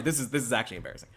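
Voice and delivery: usual speaking voice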